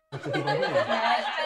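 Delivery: slow, laughing tease; teasing laugh. Real, not acted. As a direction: laugh and poke fun at the student.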